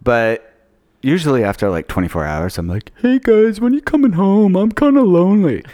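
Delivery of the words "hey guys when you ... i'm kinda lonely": high, plaintive little voice; mock lonely. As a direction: whine it up high like someone left home alone. Mimics himself missing his family.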